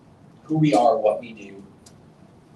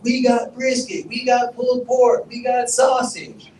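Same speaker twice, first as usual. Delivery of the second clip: high, slow, drawn-out hawker calls; stretched-out high cries of a barbecue line hawker; hawker yelling; 11 semitones higher, 3 dB louder, slower than usual. Retold or imitated. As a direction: call out the menu like a food-line barker. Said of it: "they got people yelling".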